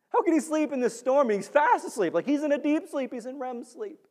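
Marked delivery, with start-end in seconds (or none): 0.1-1.3 higher, pressing disbelief; incredulous question. Acted. Preacher to congregation about Jonah, higher and incredulous. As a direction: demand it in disbelief.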